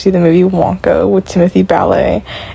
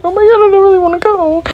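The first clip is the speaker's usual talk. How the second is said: high, whiny put-on voice, much higher; mock whiny protest; 13 semitones higher, faster than usual. In the wheelchair, whining to the lady about going out. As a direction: whine it like a reluctant kid. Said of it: "I'm like".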